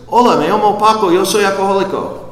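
fast, high, loud Spanish greeting; Spanish speaker. Retold or imitated. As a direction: rattle it off brightly in Spanish. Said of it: "this next guy that goes".

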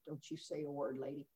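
very soft, low mock menace; barely voiced imitated threat; hushed threat. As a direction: warn as if holding someone at gunpoint. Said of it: "I've got the gun to my head".

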